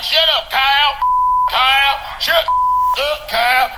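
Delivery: high, loud yelling at the cow; angry farmer. Retold or imitated. Farmer on the footage yells at his cow, loud and high. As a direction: bark at the cow like a fed-up farmer. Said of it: "the farmer's like".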